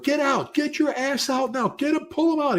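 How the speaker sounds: urgent yell. Retold or imitated. Chief ordering a firefighter out of a fire, high urgent yell. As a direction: yell them out of the burning building. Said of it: "I'd be like".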